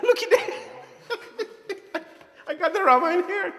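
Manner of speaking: high, slow laughing words; amused real laughter. Real, not acted. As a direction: laugh through it while showing the book. Laughing at old book.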